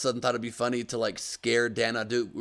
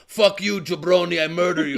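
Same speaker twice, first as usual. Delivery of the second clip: loud, higher mock wrestler rant; Iron Sheik impression; 5 semitones higher, 6 dB louder than usual. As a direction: bark it like a heel wrestler on Twitter. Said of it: "He's like".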